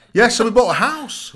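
loud, high-pitched blurt; high, belted-out news; emphatic announcement. Real, not acted. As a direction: announce it big, voice leaping up. Jokingly blurts out the big news.

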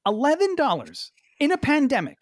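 high, mock-outraged rant; indignant. Real, not acted. Ranting about returning an eleven dollar screen, high indignant. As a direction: climb high in petty indignation.

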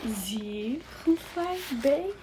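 put-on very strong French accent. A narrator's reading. The bow asking the kids about the bay, heavy French accent. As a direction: lay the French accent on thick. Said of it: "accent stronger than before".

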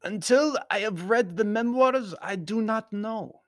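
high formal voice, slowing at the end; simply explaining Baron. A narrator's reading. Baron explaining his fear to Anthony, high voice. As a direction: explain it simply, pitched well up. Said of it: "explained the Baron simply".

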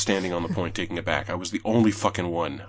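normal talking voice

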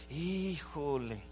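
soft, very drawn-out groan of reluctance; reluctant hedging. Acted. Church member asked to help serve, reluctant hedging. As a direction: stretch it out quietly, looking for an excuse.

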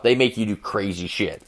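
ordinary delivery

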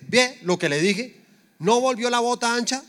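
high, triumphant "see, I told you"; mock told-you-so. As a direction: crow it like a vindicated hoarder. Acts out smug pants hoarder.

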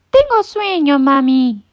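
high, sleepy little chick voice. A narrator's reading. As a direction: peep it out drowsily to mom. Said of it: "graznó". (pollito con sueño, voz aguda)

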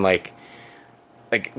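ordinary delivery

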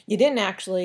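usual speaking voice

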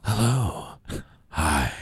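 very low "Hello. Hi." in a deep mature voice. Shown as a demonstration. Host demonstrating how a mature woman sounds. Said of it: "She starts sounding like this".

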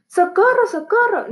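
high-pitched storybook call for help; mock cry for help. A narrator's reading. Shepherd boy repeating his prank on villagers, mock cry for help. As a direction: pitch it up like a prankster's false alarm. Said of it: "comenzó a gritar".

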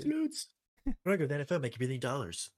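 high, loud bragging jock voice; cocky jock boast. Retold or imitated. Thad boasting about going to the NFL, high loud jock brag. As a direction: brag it up like a pumped football star.

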